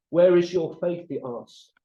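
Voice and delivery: normal talking voice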